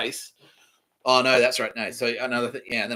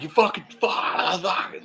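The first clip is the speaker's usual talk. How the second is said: mock rage; 9 semitones higher, much slower than usual. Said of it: "It's like".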